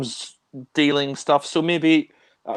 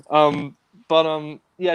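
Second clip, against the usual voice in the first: normal talking voice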